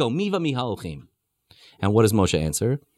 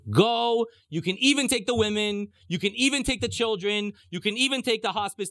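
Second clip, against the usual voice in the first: higher-pitched, mock exasperated surrender; exasperated concession; 6 semitones higher than usual. Pharaoh telling Moshe to go, exasperated. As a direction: give in with exaggerated generosity, pitched up.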